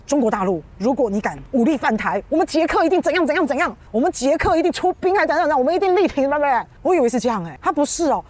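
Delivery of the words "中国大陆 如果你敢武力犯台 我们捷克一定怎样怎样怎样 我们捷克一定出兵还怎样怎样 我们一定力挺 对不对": imagined bold pledge. Pretend. Imagined Czech speaker pledging to defend Taiwan, high rousing vow. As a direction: sound like a leader promising to fight. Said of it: "我本来以为他会说".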